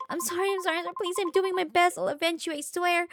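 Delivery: high-pitched, rushed-together apologies; frantic pleading. Acted. Swearing to avenge Kazuki, frantic pleading. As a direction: beg desperately, voice pitched right up.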